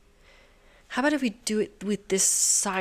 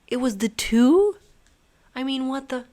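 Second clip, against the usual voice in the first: ordinary delivery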